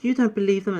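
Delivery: high, hurt question; high nymph voice asking. Acted. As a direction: press her, wounded.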